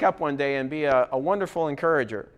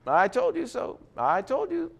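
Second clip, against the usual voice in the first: smug gloat that climbs higher with each repeat; mocking told-you-so; 5 semitones higher than usual. A condescending encourager to the one he corrects, smug building told-you-so. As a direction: rub it in, rising each time. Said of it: "it's not an opportunity to say".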